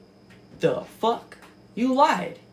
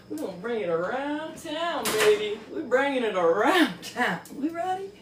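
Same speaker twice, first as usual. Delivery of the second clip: normal talking voice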